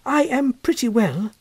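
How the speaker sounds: lightly voiced schoolboy replying to his elder; polite young boy. A narrator's reading. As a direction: answer like a shy, well-mannered boy. The boy answers his cousin.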